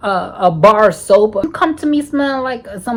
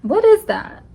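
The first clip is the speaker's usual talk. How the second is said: high, startled what is that; asking her hookup what that is, high and shocked; shocked question; 11 semitones higher than usual. Retold or imitated. Retold confrontation with hookup.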